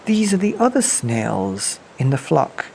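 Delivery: ordinary delivery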